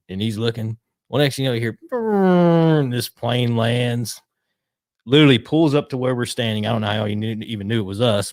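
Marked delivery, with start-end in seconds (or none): 1.9-2.8 engine noise. Retold or imitated. Plane engine sound imitated.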